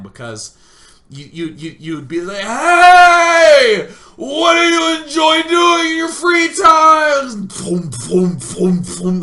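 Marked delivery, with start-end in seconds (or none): 2.4-7.5 high, drawn-out full-volume mock yell; shouting over noise. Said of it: "The noise is so loud". 7.5-9.2 loud mouth-made bass thumps; imitated club bass. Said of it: "The noise is so loud".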